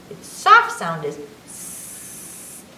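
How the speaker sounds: hissed S, slow and unvoiced; soft sound. Shown as a demonstration. Showing a child the soft C, hissing. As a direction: let the S hiss out slowly. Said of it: "C says is two sounds".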